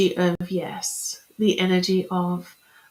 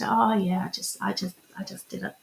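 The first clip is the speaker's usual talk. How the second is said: mock embarrassed brush-off, fading much softer; brushing off a compliment; 5 dB quieter than usual. Someone deflecting praise about their hair, fading embarrassed mumble. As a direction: shrug it away, trailing off into a mumble. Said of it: "look at the last time someone gave you a compliment".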